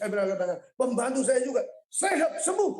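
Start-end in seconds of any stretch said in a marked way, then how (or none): 1.9-2.7 high-pitched declaration of healing; healing command. Retold or imitated. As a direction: pronounce each word as a command, pitched up high. Re-enacted healing command over helper. (perintah kesembuhan bernada tinggi)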